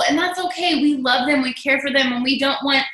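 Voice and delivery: ordinary talk, nothing marked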